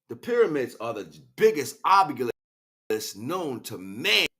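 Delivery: booming declaration, slowing on known to man; loud proclamation. Acted. Speaker proclaiming the pyramids to the listener, loud declaration. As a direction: proclaim it to the whole room.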